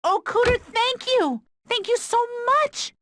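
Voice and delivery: gushing thanks. Acted. Thanking for car.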